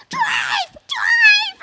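screaming drive, very high and loud; panicked shout. Retold or imitated. Liz screaming at the taxi driver, shrieking. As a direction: yell it in terror. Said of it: "Liz, next thing".

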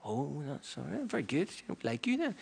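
soft, swooping little approval voice; approving listeners. Retold or imitated. Synagogue listeners warming to the persecutor, softly approving.

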